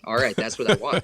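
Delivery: a loud, pleased all right; pleased exclamation. Retold or imitated. Officer loudly cheering on a Gen X caller, pleased. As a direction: cheer the caller on like a satisfied cop.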